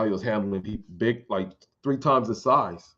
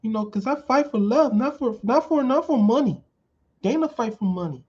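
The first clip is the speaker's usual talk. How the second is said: much higher, thin voice all through; high-pitched Anderson Silva; 13 semitones higher than usual. Retold or imitated. Mocking Anderson Silva's high voice about making weight. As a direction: squeak it out like a tiny-voiced fighter. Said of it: "he got that high-pitched voice".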